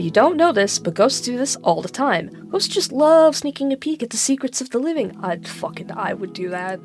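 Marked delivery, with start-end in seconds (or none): none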